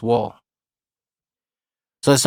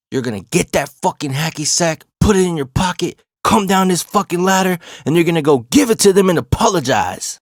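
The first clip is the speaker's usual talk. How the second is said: high, forceful dressing-down, building louder; stern retold order; 7 semitones higher, 3 dB louder than usual. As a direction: lay down the law, voice raised. Coach's angry order in retold story.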